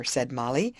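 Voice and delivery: ordinary delivery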